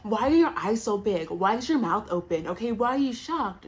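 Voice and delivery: loud, high mock interrogation; Stacey's pushy questions. Stacey quoted questioning Johnny.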